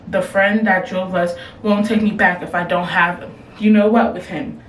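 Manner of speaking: ordinary talk, nothing marked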